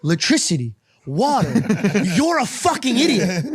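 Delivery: a hint of a scolding father; lightly voiced exasperated dad; dad lecturing. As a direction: spell it out like to a fool. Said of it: "He goes".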